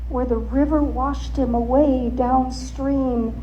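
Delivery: ordinary delivery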